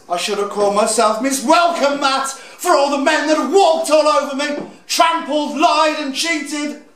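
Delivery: bitter outburst. Acted. Drag queen rails at cheating men, bitter outburst. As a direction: let the hurt burst out, pitched high.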